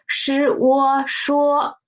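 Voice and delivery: 是我说 blended slowly into 说; slow blending. Shown as a demonstration. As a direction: join the parts slowly. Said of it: "So put them together".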